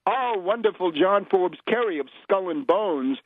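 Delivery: usual speaking voice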